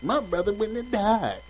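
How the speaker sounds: sassy, loud and high confrontation; with attitude. Retold or imitated. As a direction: get right up in his face. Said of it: "She probably said it with attitude".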